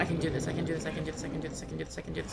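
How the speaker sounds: anxious repeated mantra fading out; nervous self-talk. Real, not acted. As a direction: chant it nervously under your breath. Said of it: "I was so nervous".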